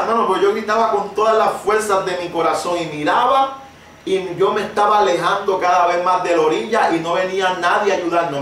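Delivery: ordinary talk, nothing marked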